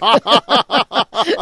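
loud, high burst of laughter; hearty laugh. Real, not acted. Commentator laughing at a founder's jab at Congress, hearty laugh. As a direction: laugh out loud, real amusement.

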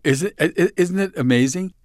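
high and loud, thrilled; bursting with delight; excited. Real, not acted. Excited reaction to compliment.

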